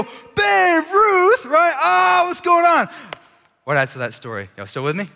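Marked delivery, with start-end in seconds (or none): 0.0-1.7 hammed-up shriek, very high and loud; acted horrified scream. Boys learning who signed the ball, mock screaming. 1.8-2.9 high, loud, drawn-out mock wail; acted panic. Boys realising the signed ball is gone, mock wailing.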